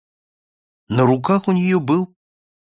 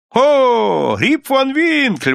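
high, drawn-out cry of recognition; exclamation; 10 semitones higher, 3 dB louder, much slower than usual. A narrator's reading. Two or three villagers recognizing the name, exclaiming. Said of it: "воскликнуло двое или трое".

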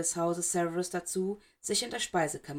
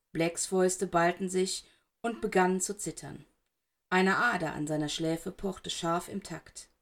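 plain reading voice

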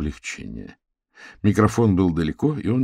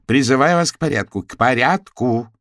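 loud, higher call to a rowdy hall; raised-voice appeal for order; shouting for order; 6 semitones higher, 7 dB louder than usual. A narrator's reading. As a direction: shout over the crowd, stretching the repeat. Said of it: "кричала она".

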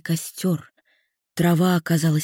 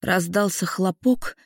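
usual speaking voice

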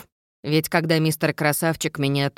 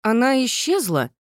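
bewildered; 7 semitones higher than usual. Acted. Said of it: "проговорила брюнетка растерянно".

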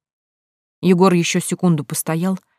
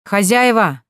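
raised, drawn-out hail; calling out; 4 semitones higher, slower than usual. A narrator's reading. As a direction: call for whoever lives here, pitched up. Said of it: "закричал Егор". (громко окликая хозяев)

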